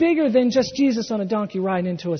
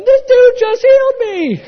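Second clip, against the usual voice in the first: much higher and louder, bursting with joy; thrilled shout; 11 dB louder than usual. Retold or imitated. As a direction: blurt it out, beside yourself. Lame man trotting around the temple.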